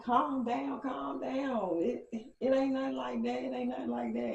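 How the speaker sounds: high-pitched, hurried-to-soothe imitation; mock placating, held high throughout; placating guy, imitated. Voicing Mike placating her.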